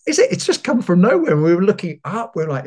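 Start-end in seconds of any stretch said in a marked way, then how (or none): 0.1-1.3 high, fast and baffled; flustered reply. Retold or imitated. Shopper explaining the mystery water to the cashier, flustered and high. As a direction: blurt it out, pitch shooting up in confusion. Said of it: "I was like".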